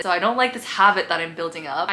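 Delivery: ordinary talk, nothing marked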